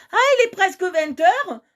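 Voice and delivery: high, loud surprised cry; startled at the hour, high and loud. Real, not acted. Realising the time, excited cry.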